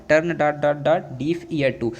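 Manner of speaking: very slow, word by word; slow classroom dictation; slow dictation pace. Shown as a demonstration. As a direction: read the blank out for students. Teacher reading the blank slowly.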